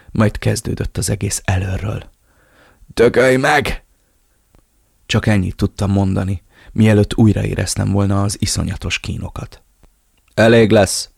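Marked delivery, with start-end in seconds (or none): 2.9-3.8 defiant curse. Acted. Tortured hero curses captor. 10.3-11.1 a firm shouted stop; shouted order. A narrator's reading. Villain halts the torture with a loud shout. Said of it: "ordította".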